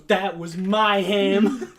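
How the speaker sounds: loud, drawn-out, high claim with laughter; mock outrage. Friend claims ham from another table, mock outrage. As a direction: stretch it out in hammed-up grievance. Acted quarrel over ham.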